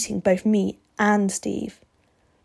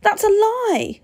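put-on high, indignant boy's yell; 13 semitones higher, 4 dB louder, slower than usual. A narrator's reading. Steve denying the vampire's accusation, yelling high. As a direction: yell back in high-pitched outrage. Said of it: "Steve yelled".

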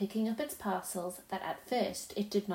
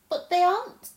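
almost laughing; 7 semitones higher, 5 dB louder than usual. Acted. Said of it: "almost laughing".

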